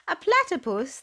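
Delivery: they can't believe it; exclaimed. Acted. Animals hearing his name, high astonished exclamation. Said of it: "they all exclaimed".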